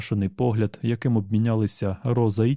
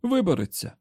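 higher urgent shout; alarmed higher yell; Tian shouting; 9 semitones higher than usual. Acted. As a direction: cry out a warning to Eddie. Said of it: "закричав Тіан".

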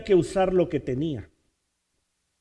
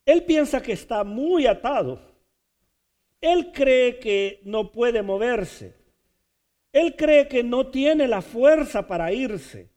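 emphatic preaching; 7 semitones higher, 4 dB louder than usual. Acted.

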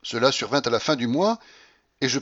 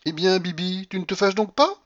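put-on lightly raised, coaxing young woman; a hint of a playful mistress; Blanche coaxing; 8 semitones higher than usual. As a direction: tease him, sweetly. Blanche voiced by reader.

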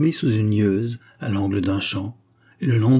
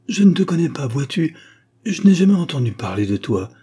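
lightly voiced little girl; 5 semitones higher, 4 dB louder than usual. A narrator's reading. Girl answers the devil.

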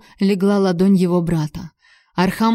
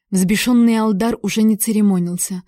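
ordinary delivery, nothing marked

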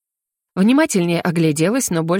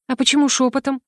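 ordinary delivery, nothing marked

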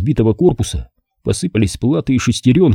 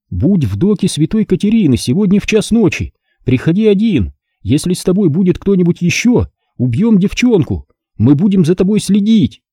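high, strained hostage reading; tense, fearful; 9 semitones higher than usual. A narrator's reading. Captive reads the kidnappers' demands onto tape, tense and afraid. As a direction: read it out high and tense, a touch of fear. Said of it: "голос Тины звучал резко и напряжённо".

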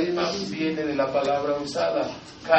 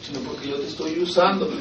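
God answering; 4 semitones higher, 4 dB louder than usual. Retold or imitated. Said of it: "y voy a".

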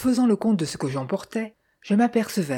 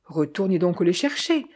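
ordinary delivery

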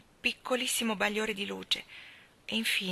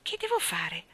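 stammered. A narrator's reading. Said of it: "balbettò Jane".

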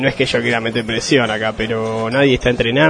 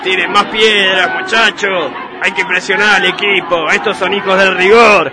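hincha gritando; 7 semitones higher than usual. Acted. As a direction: yell it from the stands like a rowdy fan. Barra alentando a gritos. (hincha gritón, agudo y lento)

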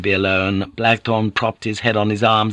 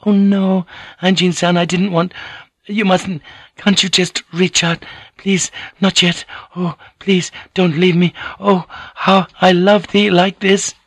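put-on high, pleading, accented lover; passionate Japanese lady; 9 semitones higher than usual. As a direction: implore him in a high woman's voice. Voices Mariko during lovemaking.

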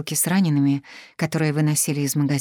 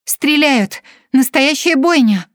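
panicked shout; 10 semitones higher, 8 dB louder, slower than usual. Acted. Panicked woman bursts in.

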